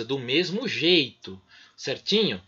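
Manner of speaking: usual speaking voice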